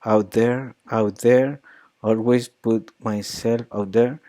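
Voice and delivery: "out there" alargado con tono subido; stress on out there. Shown as a demonstration. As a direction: estira "out there" y sube el tono. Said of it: "longer and she raised the pitch".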